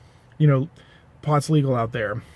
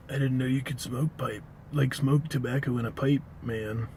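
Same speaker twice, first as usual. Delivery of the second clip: softer, spaced-out stoner drawl fading away; 7 dB quieter than usual. Retold or imitated. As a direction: mumble like a baked dude. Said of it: "that accent was".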